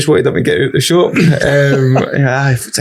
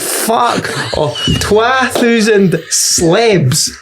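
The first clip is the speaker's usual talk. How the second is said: higher, very slow Fife drawl on twa thousand slabs; mocking Fife echo; 8 semitones higher, much slower than usual. Comedian throws the customer's words back in broad Fife, mocking. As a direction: echo him back in his own accent, mocking. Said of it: "I went".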